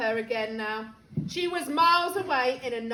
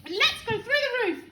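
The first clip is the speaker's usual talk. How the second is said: high, loud, eager screech; old screechy voice; 8 semitones higher, 6 dB louder, faster than usual. A narrator's reading. Grandma wanting another dose, old screechy voice. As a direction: screech your demand downward. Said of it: "came the old screechy voice".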